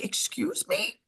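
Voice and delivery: mock indignant; offended stranger. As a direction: squeak it like a startled lady. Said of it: "she's like".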